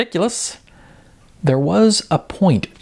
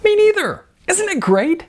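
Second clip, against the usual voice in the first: much higher, gushing squeal of joy; beaming delight. A narrator's reading. Model beaming at Sarah, squealing with delight. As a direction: gush like the happiest girl alive. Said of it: "Tracy beamed".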